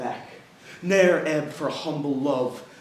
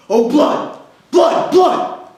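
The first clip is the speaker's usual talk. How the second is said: much higher, roaring cry; furious shout; 12 semitones higher, 14 dB louder, slower than usual. Acted. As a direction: scream it in fury. Othello cries for blood.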